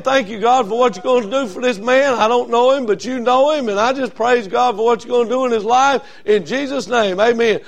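a woman praying over the preacher, fervent; fervent prayer. Retold or imitated. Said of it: "she started praying".

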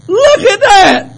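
loud, high, drawn-out exclamation; God's astonished shout. Acted. God looks down on one man, an amazed shout. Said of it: "God looked down there and said".

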